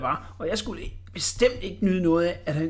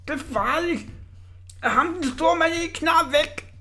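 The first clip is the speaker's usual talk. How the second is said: whimpering fright; 11 semitones higher than usual. Acted. Whimpering Orla inside cannon.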